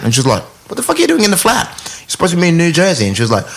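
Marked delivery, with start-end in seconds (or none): 0.7-3.1 high mock disbelief; retold incredulous scolding. Friend calling him at the flat, imitated incredulous scolding. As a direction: shoot up in pitch as the baffled friend.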